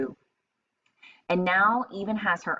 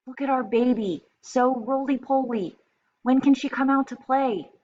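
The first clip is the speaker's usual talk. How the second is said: put-on higher, louder gushing over a baby; proud animals fussing over the baby hippo; excited zoo animals; 7 semitones higher, 4 dB louder than usual. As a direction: coo with delight at the new baby.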